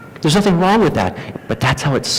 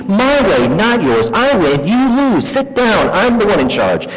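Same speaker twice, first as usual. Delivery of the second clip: high, raised, punchy commands; heated power struggle, pitched up; domineering quarrel; 8 semitones higher, 3 dB louder, slower than usual. Acted. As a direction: hammer each claim at a rival. Acting out the quarrelling church groups.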